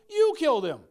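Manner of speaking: loud, pointed accusation at the crowd; accusing shout. Acted. Peter accusing the crowd, loud and sharp. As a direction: jab the finger and shout it. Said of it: "Peter points to the crowd and goes".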